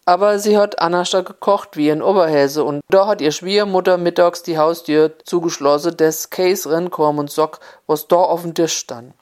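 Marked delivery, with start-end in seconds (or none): none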